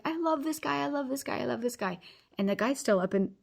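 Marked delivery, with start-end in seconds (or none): 0.1-2.0 high-pitched gushing, gradually easing down; excited child. Retold or imitated. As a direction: imitate a son gushing excitedly to his mom. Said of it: "he's getting super excited".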